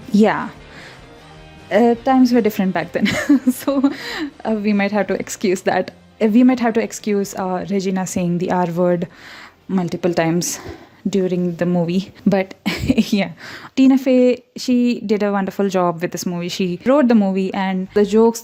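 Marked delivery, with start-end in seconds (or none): none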